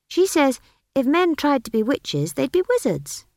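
pert, high-pitched retort; defiant child. A narrator's reading. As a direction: argue back like a stubborn child. Child Esk argues.

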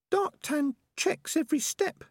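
very high-pitched little rat; Peaches the rat. A narrator's reading. Rat reassuring her waiting companion, very high-pitched. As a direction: speak in a tiny high rat voice.

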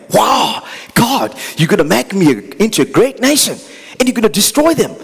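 thrilled at being made great, shouted up high; greedy excitement. Acted. Glory-seeker thrilled at God's offer, high excited shout. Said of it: "those who are self-seeking for glory".

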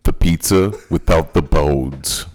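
loud slow deadpan order. Pretend. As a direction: shout it slowly and flatly. Caller shouts order slowly.

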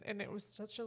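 normal talking voice

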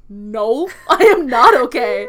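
high, loud, slow laughing cry of not okay; laughing despair. Retold or imitated. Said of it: "And I'm like".

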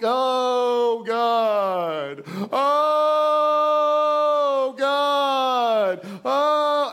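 long, loud imitated groans to God; hammed-up prayer-meeting moaning, held on and on; mock moaning prayer. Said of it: "just moan and groan and moan".

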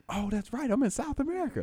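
high sheepish realization; mock realization. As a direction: play dumb, realizing late.

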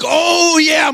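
high, elated fan shout; acted cheering. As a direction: cheer like a kid watching wrestling. Acts young fans cheering the comeback.

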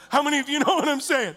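normal talking voice

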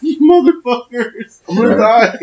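hammed-up death wail at his friends; mock dying wail. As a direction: cry out as if about to die. Screaming he will die.

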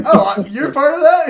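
high, loud, laughing disbelief; mock surprise. Imagined listener hears who the speaker is, mock surprise. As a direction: blurt it out as an astonished listener, half laughing.

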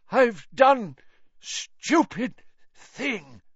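high, strained, broken words; halting gasp of confession; breathed with effort. A narrator's reading. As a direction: drag each word out slowly as if it hurts. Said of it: "Mister Crouch breathed".

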